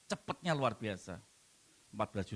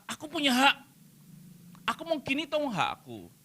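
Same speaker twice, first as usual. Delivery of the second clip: entitled voice; 11 semitones higher than usual. Retold or imitated. Mocking a self-entitled believer.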